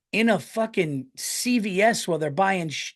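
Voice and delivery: normal talking voice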